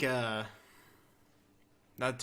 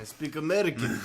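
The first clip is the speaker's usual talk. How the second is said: put-on comic Russian accent, raised; 4 semitones higher, 6 dB louder than usual. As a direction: say it in mock Russian. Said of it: "or Russia".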